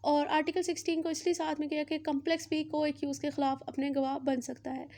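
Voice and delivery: usual speaking voice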